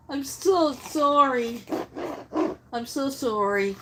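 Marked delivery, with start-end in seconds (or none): 0.1-1.6 heartfelt sympathy. Real, not acted. Reading out a viewer's news of her dog, slow and heartfelt. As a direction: let the sorrow slow you down.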